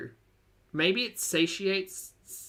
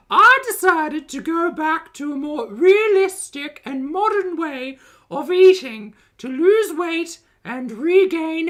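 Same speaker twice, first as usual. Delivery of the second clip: high, loud, slow sing-song vlogger; Foodie Beauty voice; 9 semitones higher, 9 dB louder, slower than usual. Retold or imitated. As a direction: announce it like a chirpy YouTube intro. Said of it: "my foodie voice".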